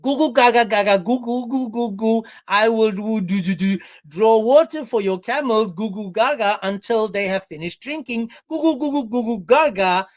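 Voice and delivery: put-on loud, sing-song toddler gibberish; hammed-up mocking baby talk; baby babble. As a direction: babble like a baby between the solemn words. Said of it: "she said".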